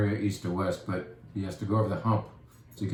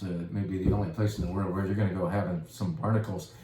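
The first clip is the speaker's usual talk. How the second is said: usual speaking voice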